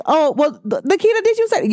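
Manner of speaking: high, incredulous imitation of upset people; mock upset questioning. Imitating people upset at her. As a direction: pitch it up like a shocked accuser. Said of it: "they be like".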